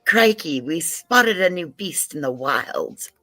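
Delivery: high, drawn-out British accent; English accent. Shown as a demonstration. Co-host performing on request, hammed-up English accent. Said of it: "use your best English accent".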